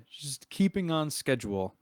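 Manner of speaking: very pointed, deliberate nudge. Acted. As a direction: repeat it pointedly as a hint to move on. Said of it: "he'll say that very pointedly".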